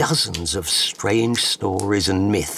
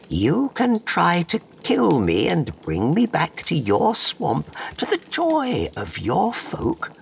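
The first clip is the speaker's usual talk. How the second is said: high, slow, otherworldly fairy bug; enchanting magical creature voice; clicking beetle; 9 semitones higher than usual. Acted. Fairy bug speaks to hunter.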